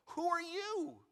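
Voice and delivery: put-on high, loud, alarmed old man. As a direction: shoot the question out in alarm. Isaac startled, voiced in the story.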